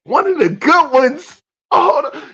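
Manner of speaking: high, loud incredulous echo; stunned and offended, voice jumping up; outraged disbelief. Real, not acted. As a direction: repeat it back in shocked outrage.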